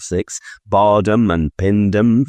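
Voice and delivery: usual speaking voice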